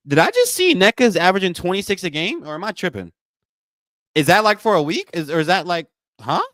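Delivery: high, loud double take; real disbelief. Real, not acted. Host startled by a player's average, disbelieving. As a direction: question it in baffled surprise.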